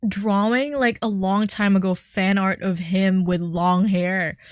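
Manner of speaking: ordinary delivery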